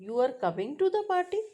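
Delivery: "You are coming to the party" fast and high, climbing at the end; rising question. Shown as a demonstration. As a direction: push the pitch up through "party". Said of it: "So then pitch rises in the end".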